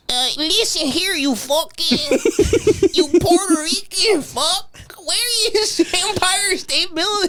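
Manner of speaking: high, slow mock Chinese accent, breaking into laughs; attempted Chinese accent. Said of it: "That was a hard accent to try".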